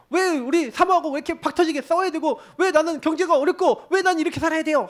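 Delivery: high, heated complaint; retold quarrel with God; arguing with God. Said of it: "하나님 앞에 막 따졌어요".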